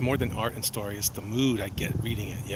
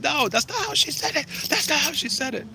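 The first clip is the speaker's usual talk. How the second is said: high, strained comic retort; high silly impression of a comedian's protest; mock high voice. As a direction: squeak it out as an indignant comedian. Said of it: "And he said".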